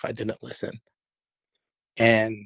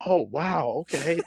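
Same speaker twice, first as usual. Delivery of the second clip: higher mock-surprised oh wow; 6 semitones higher than usual. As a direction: play their delighted surprise, pitched up. They react to the news.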